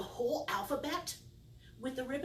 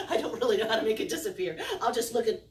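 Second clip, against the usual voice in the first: fast, louder, laughing through words; 3 semitones higher, 8 dB louder, faster than usual. Real, not acted. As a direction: laugh while admitting it. Laughing at the failed trick.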